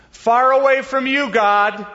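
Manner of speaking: high, loud confession; Adam answering God, loud and high; Adam's answer. Retold or imitated.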